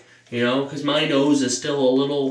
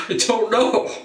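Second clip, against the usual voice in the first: giggly; 7 semitones higher than usual. Retold or imitated. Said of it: "I could not stop laughing".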